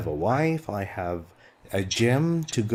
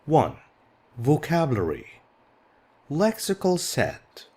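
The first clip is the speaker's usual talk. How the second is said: ordinary talk, nothing marked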